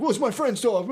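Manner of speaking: high-pitched mock excuse; imitating whiny owner. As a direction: voice a flustered man making excuses. Mimics the flustered dog owner.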